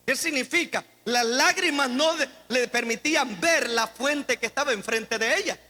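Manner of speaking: ordinary talk, nothing marked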